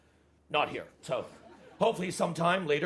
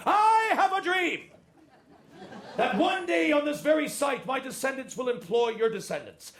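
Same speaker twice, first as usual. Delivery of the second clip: high, ringing speech with stretched words; hammed-up preacher oratory; 7 semitones higher than usual. Boss reading his scripted finale to staff, mock-inspirational oratory. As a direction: proclaim it like a great rallying orator, high and sustained. Parodies famous dream speech.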